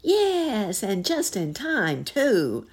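high, loud rat's cry; excited cry. A narrator's reading. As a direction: cry it eagerly, starting very high. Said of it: "cried another".

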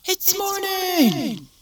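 put-on excited kid shout. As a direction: wake up screaming it's morning.

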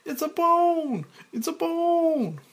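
put-on very high, loud children's cry; shouting kids. Kids shouting back at Mike, high loud chorus. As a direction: call it out like excited kids. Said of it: "Kids shouting".

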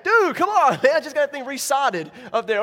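loud, high, exasperated complaint; mock annoyed homeowner. Homeowner griping to neighbors about his roof, mock annoyed. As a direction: whine about the ruined roof.

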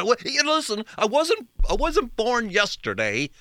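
high-pitched mock parental rebuke; parent not fooled. Parent to a child trying to get around them, high mock rebuke. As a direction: imitate a parent seeing through the excuse. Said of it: "you know, they say".

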